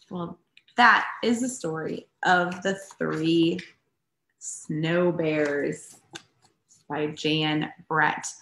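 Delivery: usual speaking voice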